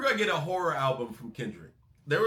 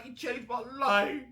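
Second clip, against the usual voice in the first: higher, wide-eyed mock worship; mock awestruck fan; 6 semitones higher than usual. Fan gushing over Jay's bars, mock awestruck. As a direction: gush like a fan who idolizes Jay. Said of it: "We look at Jay when he does those bars".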